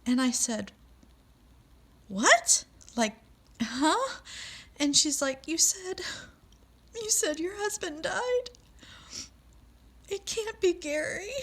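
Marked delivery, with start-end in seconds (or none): 2.1-4.2 higher, louder startled "what?"; shocked disbelief. Retold or imitated. Hearing shocking news. 5.5-11.3 imitating tearful disbelief. Tearful stranger retold by speaker.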